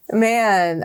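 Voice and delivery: ordinary talk, nothing marked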